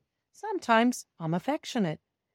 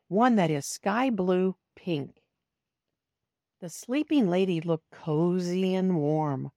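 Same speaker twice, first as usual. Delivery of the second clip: usual speaking voice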